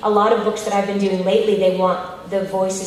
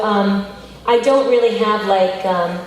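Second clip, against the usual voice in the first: ordinary talk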